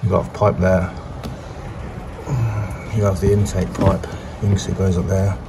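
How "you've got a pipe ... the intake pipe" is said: ordinary talk, nothing marked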